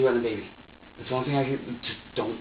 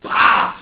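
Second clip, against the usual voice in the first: loud, low "bah" of a body check; impact noise; 11 dB louder than usual. Retold or imitated. Showing how hard they body check people, explosive impact noise. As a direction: burst it out like a hard hit.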